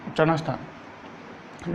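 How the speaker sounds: उच्चारण स्थान whispered, barely audible. Real, not acted. Teacher writing the term on the board, whispering it. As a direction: breathe उच्चारण स्थान out under the breath. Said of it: "teacher repeats term while writing".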